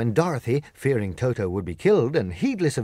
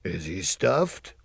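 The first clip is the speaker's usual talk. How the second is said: surprised; 3 semitones lower, much slower than usual. Acted. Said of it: "asked the lion in surprise".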